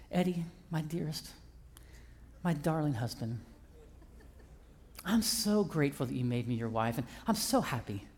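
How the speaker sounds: lightly voiced wife cooing at her husband; sweet wife. Husband retells wife's sweet request.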